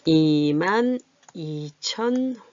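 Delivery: slow, each place value held; stretched number, part by part; drawn out while writing. Real, not acted. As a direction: say it slowly as you write.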